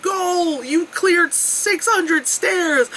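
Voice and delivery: high, slow triumphant announcement; mock banner voice. Reading the summit banner, slow high mock triumph. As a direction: read the sign like a proud announcer. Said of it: "that's like".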